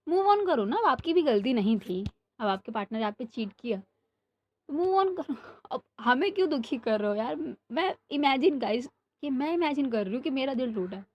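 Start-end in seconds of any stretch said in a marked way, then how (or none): 0.1-0.8 exasperated advice. Retold or imitated. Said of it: "I'm like". 4.7-7.6 exasperated advice. Retold or imitated. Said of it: "I'm like".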